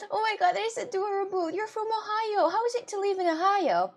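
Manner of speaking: high-pitched, adoring excitement; gushing delight. Real, not acted. Asking a viewer about living in Ohio, gushing. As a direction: coo high with delighted interest.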